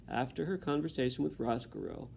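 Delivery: usual speaking voice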